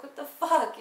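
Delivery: loud, high shocked outburst; loud high shocked cry; shocked yell. Retold or imitated. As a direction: shout it in startled outrage. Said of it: "she's just like".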